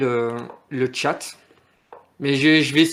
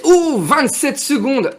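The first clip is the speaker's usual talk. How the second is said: sudden high alarmed cry; jolted by the ticking clock; 12 semitones higher, 8 dB louder, much slower than usual. Real, not acted. Blitz clock nearly out.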